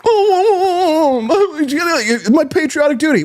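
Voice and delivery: high, prissy mock of a patriot. Host mocking a pious objector's patriotic duty, high and prissy.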